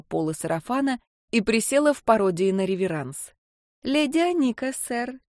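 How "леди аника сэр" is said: put-on high, coy little-girl voice; coquettish. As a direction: play the prim little lady. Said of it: "кокетливо произнесла девочка".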